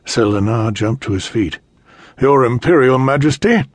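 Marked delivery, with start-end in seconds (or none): 2.2-3.6 knight's fury, higher and louder; furious. Acted. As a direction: spit the title out in rage. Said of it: "in a voice full of fury".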